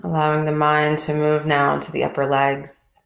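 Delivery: calm, even voice with long pauses between phrases; guided meditation. Real, not acted. Teacher leading a group body scan from toes upward, calm guided meditation.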